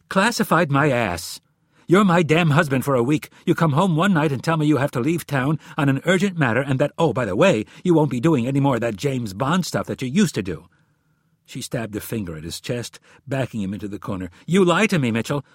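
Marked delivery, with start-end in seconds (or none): 0.1-10.7 raised, louder wifely tirade; angry. A narrator's reading. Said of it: "looked angrily at her husband". 14.5-15.4 high, loud reproach; angry accusation. A narrator's reading. Wife accuses husband in quarrel.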